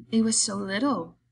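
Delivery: mildly sorry, simple-minded; apologetic. A narrator's reading. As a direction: explain it sheepishly, like a child. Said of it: "he said apologetically".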